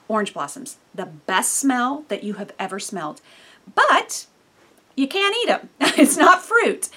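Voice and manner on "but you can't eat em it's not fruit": playful emphasis. Real, not acted. Mock warning about blossoms.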